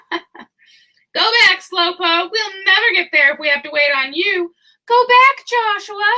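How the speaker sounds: taunting. Acted. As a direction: sneer him away from the flock. Lambs taunt the crippled lamb.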